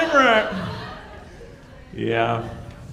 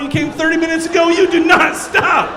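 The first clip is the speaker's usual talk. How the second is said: "you do not stop" yelled louder and higher, each word hit; shouted; 7 semitones higher, 5 dB louder than usual. Shown as a demonstration. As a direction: hammer "do not stop" as a loud command. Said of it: "If a woman says".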